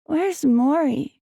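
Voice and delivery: put-on high, slow child's question; a tired kid asking for someone; sleepy little boy. A narrator's reading.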